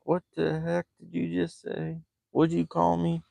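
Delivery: react, taken aback; baffled retort. Retold or imitated. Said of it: "was like".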